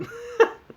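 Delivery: sudden high-pitched laugh; real high laugh. Real, not acted. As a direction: burst out laughing. Laugh after joke.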